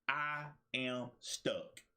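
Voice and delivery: "I am stuck" drawn out word by word; slow, deliberate "I am stuck". Shown as a demonstration. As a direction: say "I am stuck" slowly, owning it. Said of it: "you realize you stuck".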